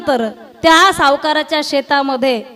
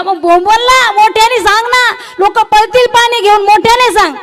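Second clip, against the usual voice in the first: louder, higher exasperated telling-off; exasperated master; 7 semitones higher, 6 dB louder than usual. Retold or imitated. Master scolding servant for not shouting about the fire, loud and exasperated. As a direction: demand he yell it out.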